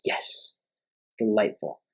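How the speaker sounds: soft, quiet mock delight; mock savoring. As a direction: murmur it like a food critic pleased. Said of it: "And you're like".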